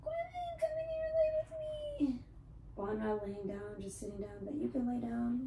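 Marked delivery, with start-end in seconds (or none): 0.0-2.2 much higher, cooing pet voice. Real, not acted. Baby talk to cat.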